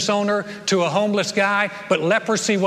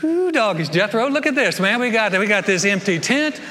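hillbilly glee; 2 semitones higher than usual. Retold or imitated. Lepers finding an empty tent, in hammed-up hillbilly glee. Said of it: "they're like".

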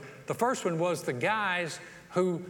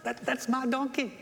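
higher, bright-eyed boast about his donkey; donkey owner bragging as Jesus rides by, higher and excited; proud excited owner; 7 semitones higher than usual. Retold or imitated. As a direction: show off like a thrilled kid. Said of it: "He's like".

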